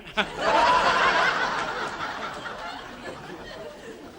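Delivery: silent high wheeze; real wheezing laugh. Real, not acted. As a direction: laugh without voice. Laughs at own joke.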